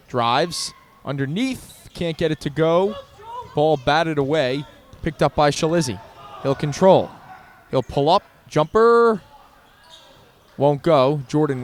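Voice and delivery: rising excitement on the drive; excited play-by-play. Real, not acted.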